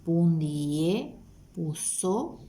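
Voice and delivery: "Pundie puso" drawn out slowly, rising at the end; slow classroom repetition of "Pundie puso"; slow repeat. Shown as a demonstration. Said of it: "Ñamétahína guaraní".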